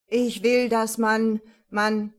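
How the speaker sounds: loud, halting stammer; insistent but stuck mid-sentence. A narrator's reading. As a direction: stumble and repeat, struggling to get it out. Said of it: "Emil stotterte weiter".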